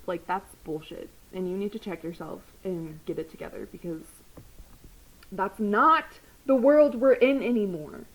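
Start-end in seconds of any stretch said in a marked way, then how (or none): 5.3-8.1 slow, loud emphatic demand; angry. Real, not acted. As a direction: hammer each word at bad cops. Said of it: "angry closing rant".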